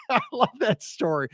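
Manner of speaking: high, laughing appreciation. Real, not acted. Host tickled by a listener's ladder story, laughing. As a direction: say it through a burst of laughter. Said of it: "here's why I'm laughing".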